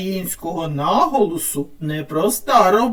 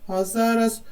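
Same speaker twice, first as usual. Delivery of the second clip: usual speaking voice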